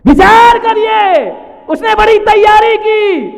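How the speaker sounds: high, slow, full-force shout; thundering appeal. Real, not acted. Preacher urging thought for the wife's preparations, shouted. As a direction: urge the crowd at the top of your voice.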